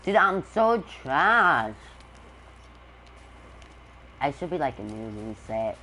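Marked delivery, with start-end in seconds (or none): 0.0-1.7 high, loud and slow self-pity; whiny groan. Real, not acted. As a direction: drag it out in despair. Complaining about bad gameplay.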